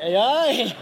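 loud, high mock Scottish A I; light Scottish accent, laughing. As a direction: say A I like a Scotsman. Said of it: "Sounded a bit Scottish as well".

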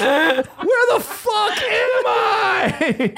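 very high, stretched-out mock cry; panicked wail. Newcomer lost among camels, hammed-up panicked wail. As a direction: wail each word slowly in falsetto.

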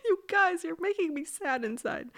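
high, pouty little complaint; hammed-up hurt feelings; mock sadness. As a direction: pout it high, playfully wounded. Mock sad British rulers.

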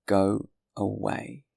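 slow, spaced-out command; gruff dismissal. A narrator's reading. Jean Valjean dismisses the boy, slow and stern. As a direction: send the child off, word by word.

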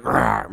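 low growled rawr, a big cat's roar; mountain lion roar. Shown as a demonstration. Roaring like a mountain lion to show the listener. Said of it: "I'm talking like".

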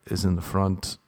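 ordinary talk

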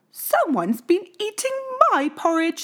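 high, lightly voiced mother bear; Mama Bear. A narrator's reading. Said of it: "said Mama Bear".

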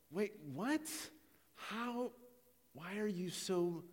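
high, soft, halting questions; baffled disbelief. Retold or imitated. Someone facing a sudden outburst over little, baffled. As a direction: falter in hushed bewilderment.

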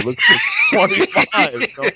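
laughing through the words; cracking up mid-sentence. Real, not acted. Laughing at Slam video.

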